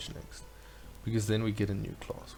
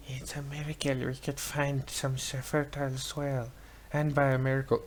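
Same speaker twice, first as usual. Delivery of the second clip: put-on old grandma voice. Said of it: "Is that the lady from Moana?".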